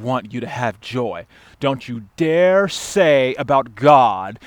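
slow, forceful scolding; stern rebuke. Acted. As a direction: rebuke them word by weighty word. Pastor rebukes the complainer.

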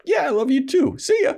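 very high, flippant send-off; mock snickering. Angel heading out on a tearful Buffy, mock snickering brush-off. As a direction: toss it back as a hammed-up jeer. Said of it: "snickers, like".

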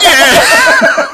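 very high, loud acted cheer; troops' wild cheer. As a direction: scream it like soldiers celebrating. Acting troops cheering the new president.

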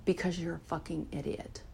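softly voiced inner shame hag; mock whisper. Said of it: "will then whisper to me".

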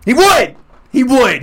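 loud, high insistence; emphatic. Real, not acted. Friend insisting Dexter wins, loud and emphatic.

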